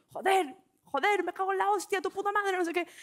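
high-pitched shouted curses; screaming swears. Retold or imitated. Losing it in self-defense class, a high swearing yell. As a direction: let the swearing explode. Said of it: "empecé simplemente a gritar".

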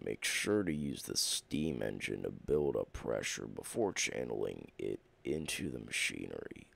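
reading the notes in a very low, rumbling voice; a gruff low narrator reading instructions; deep put-on voice. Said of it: "I like to go into different accents".

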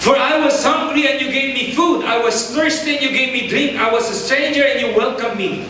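usual speaking voice

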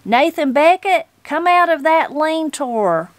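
high, loud call across the yard. A narrator's reading. Granny calling Grandsir out of the lean-to, loud call. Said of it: "she called".

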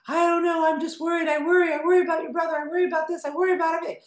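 high, loud fretting mother voice; worried mother. Retold or imitated. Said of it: "my mom was a worrier".

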